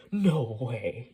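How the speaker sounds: put-on loud childlike amazement; like a child. A narrator's reading. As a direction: blurt it like an awestruck kid. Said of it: "he said like a child".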